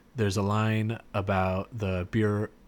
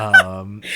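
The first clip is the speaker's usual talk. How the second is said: loud laugh; 14 dB louder than usual. Real, not acted. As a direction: cackle out loud at the joke. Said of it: "laughing at own quote".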